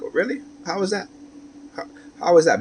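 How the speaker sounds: high mocking "oh, really?"; sarcastic disbelief. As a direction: scoff at her claim, high and incredulous. Sarcastic reply in argument.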